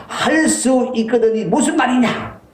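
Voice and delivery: high, slow reproachful rebuke; a preacher's mock scolding; rebuking Jesus. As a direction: scold the doubting father. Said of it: "호통을 치시면서".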